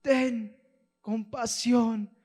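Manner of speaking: very high, very slow, loud desperate shout. Acted. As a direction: scream for mercy with everything left. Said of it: "él grita más fuerte".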